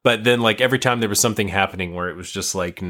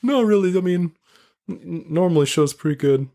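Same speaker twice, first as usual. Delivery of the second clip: high, pleading voice, stammering in embarrassment; sheepish pleading; 9 semitones higher than usual. Retold or imitated. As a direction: beg her to believe the show is usually good. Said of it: "I was like".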